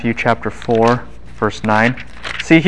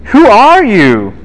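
indignant; 9 semitones higher, 5 dB louder, slower than usual. Real, not acted. Teacher exclaims indignantly.

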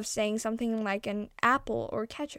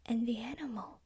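whisper; 4 dB quieter than usual. A narrator's reading. Suspenseful whispered narration.

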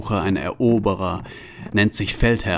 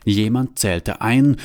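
usual speaking voice

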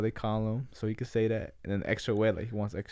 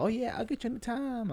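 mock waiter; 10 semitones higher, 7 dB quieter than usual. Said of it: "people behind him was like".

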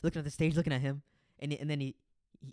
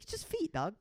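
much higher exasperated plea; mock reasoning; 9 semitones higher than usual. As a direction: talk a friend down from it. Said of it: "It's like".

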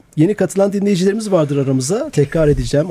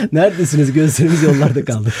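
laughing. Real, not acted. Host laughing greeting late guest.

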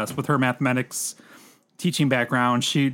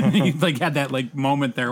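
ordinary delivery

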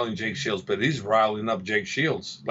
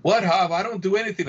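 higher, a bit louder denial; mock innocent D C; 6 semitones higher, 4 dB louder than usual. D C brushing off Hop, higher mock innocence. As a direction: pitch up, feigned innocence. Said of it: "Please don't instigate".

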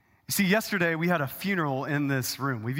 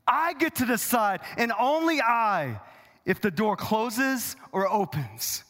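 high, emphatic claim of sole authority; forceful preaching; 7 semitones higher than usual. Acted. Jesus claiming he alone decides, forceful preaching. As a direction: declare it weightily, slowing toward the end.

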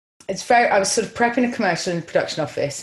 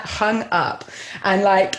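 ordinary delivery

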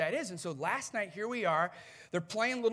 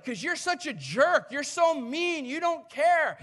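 loud, high, indignant railing; acted angry accusation; 7 semitones higher, 8 dB louder than usual. Imagined Jonah berates God, acted anger. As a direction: hurl each complaint like an accusation.

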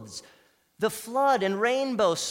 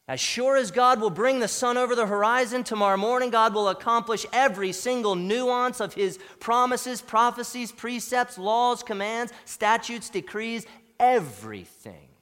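loud, raised, driving sermon delivery; full-force preaching; 3 semitones higher, 5 dB louder than usual. Real, not acted. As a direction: hammer through the list to a weighty last word.

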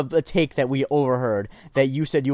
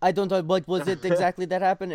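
put-on Russian accent; 5 semitones higher than usual. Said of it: "he also had a Russian accent".

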